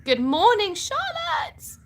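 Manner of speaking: high, loud sing-out hello; exuberant greeting. Real, not acted. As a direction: call out a greeting with a big high lift. Calls out greeting to a joining viewer.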